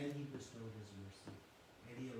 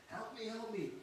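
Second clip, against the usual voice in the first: exaggerated child's cry; 8 semitones higher than usual. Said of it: "children tend to exaggerate drama".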